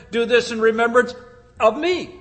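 pause, then lift and lean on "me"; stress on "of me". Shown as a demonstration. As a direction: hold back "of me", then stress it. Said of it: "The emphasis is there".

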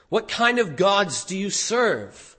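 usual speaking voice

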